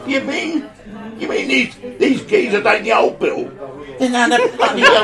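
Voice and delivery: high, loud, laughing outrage; indignant disbelief. Acted. Charlie realises they were fooled, high and loud. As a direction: shout in disbelief at the trick.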